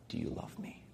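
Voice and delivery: hushed whisper, almost no voice; Jesus whispering to Peter. Acted. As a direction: breathe the question as a whisper. Quoting Jesus' final question.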